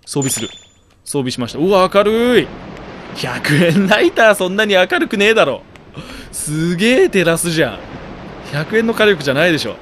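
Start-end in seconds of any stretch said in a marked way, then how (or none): 1.6-9.8 higher, louder amused disbelief; real surprise at the glare; excited incredulous comments; amazed. Real, not acted. Lighter is absurdly bright.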